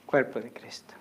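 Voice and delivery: hushed whisper. Real, not acted. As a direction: whisper it reverently. Giving communion.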